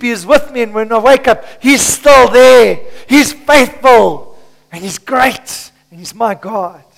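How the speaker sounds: loud, ringing preacher's delivery; preaching at full force. Acted. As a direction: preach it out to the congregation.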